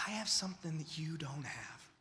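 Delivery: soft, hushed confiding whisper. Real, not acted. Preacher whispering what believers carry into darkness. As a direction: drop to a secret whisper.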